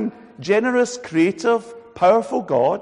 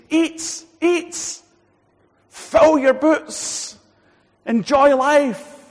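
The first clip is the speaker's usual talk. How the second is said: joyful urging; 11 semitones higher, 6 dB louder, much slower than usual. Retold or imitated. God urging Adam to enjoy.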